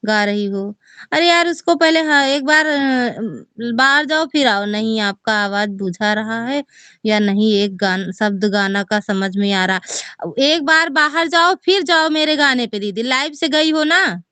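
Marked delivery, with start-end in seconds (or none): 1.1-4.7 higher, louder urgent instructions; excited. Real, not acted. Urging her sister to leave and rejoin, excited. 10.4-14.2 higher, insistent urging; excited. Real, not acted. Urging her sister to rejoin the live, excited.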